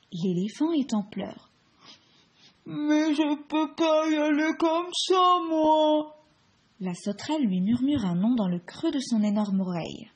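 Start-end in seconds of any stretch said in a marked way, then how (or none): 2.7-6.2 whiny elephant voice. Acted. Elephant in tears.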